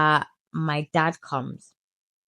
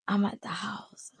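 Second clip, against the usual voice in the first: hushed; 3 semitones higher, 7 dB quieter than usual. Real, not acted. Hushed aside.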